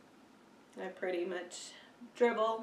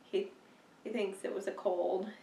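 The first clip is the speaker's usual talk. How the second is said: usual speaking voice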